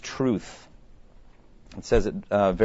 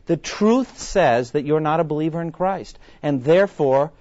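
usual speaking voice

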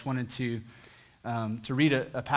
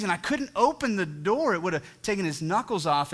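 ordinary talk

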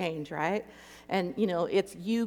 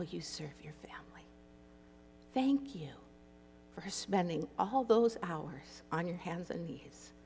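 tender whisper; 2 semitones lower, 9 dB quieter than usual. Retold or imitated. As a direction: hush it like comforting a tired child. God's tender words to weary mom.

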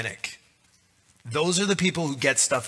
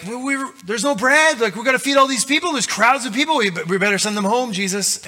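high, fast, loud panicky pleading; panicked disciples; 9 semitones higher, 5 dB louder, faster than usual. Retold or imitated. Disciples begging Jesus about the hungry crowd, high panicky rush.

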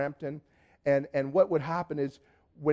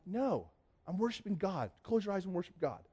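hushed refusal; 3 semitones higher, 7 dB quieter than usual. Retold or imitated. Hushed refusal during worship, retold.

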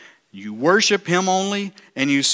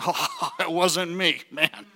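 soft laugh through words; 4 dB quieter, slower than usual. Real, not acted. Preacher laughing to church. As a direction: chuckle it out.

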